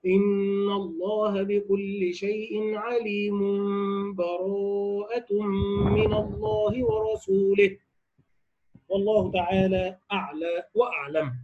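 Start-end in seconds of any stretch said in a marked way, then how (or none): 0.1-7.8 recitation joined through. Shown as a demonstration. Teacher demonstrating wasl between Anfal and Tawba, slow recitation. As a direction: recite it in one breath, joining both suras. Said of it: "وهو الوصف هكذا".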